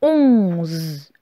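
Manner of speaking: syllabe seule, plus grave et posée; dictée articulée. Shown as a demonstration. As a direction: dicter lentement à l'élève. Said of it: "on va écrire la syllabe". (voix de dictée grave)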